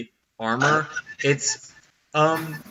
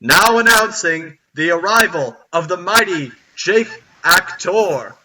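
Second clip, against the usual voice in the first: loud, raised ceremonial proclamation; herald's announcement; 5 semitones higher, 6 dB louder, slower than usual. Acted. Butler announces guests to the castle halls, grand proclamation. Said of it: "declares into the halls".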